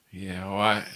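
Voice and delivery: low, quiet acted mumble; subdued mock reply. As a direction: play it down softly after the disaster. Retells limping back, embarrassed.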